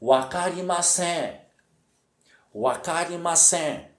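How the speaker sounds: teacher modelling "wakarimasen" with a nasal final syllable; model pronunciation. Shown as a demonstration. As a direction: say "wakarimasen" slowly and evenly for learners. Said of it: "a pronúncia é".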